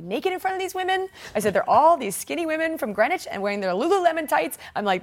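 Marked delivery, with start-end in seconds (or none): 0.0-1.5 high-pitched, emphatic mock protest; lightly acted indignant objection; retold protest. Retold protest about locker room. 1.5-4.6 high-pitched mock complaint; lightly acted, indignant griping; retold protest. Retold protest about locker room.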